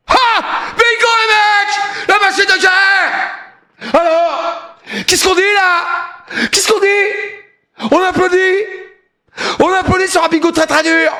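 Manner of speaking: high, drawn-out celebratory shouting; excited shout. Real, not acted. Streamer celebrating a very hard bingo, excited shout. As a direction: crow it out high and triumphant.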